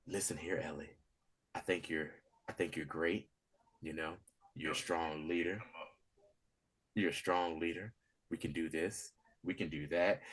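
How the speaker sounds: hushed, low creepy charm; a creepy man sweet talking a captive girl, very soft. Retold or imitated. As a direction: coax her softly like a predator.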